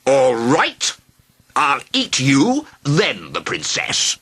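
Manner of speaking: threaten, getting crosser each line; increasingly testy. A narrator's reading. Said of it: "becoming increasingly testy".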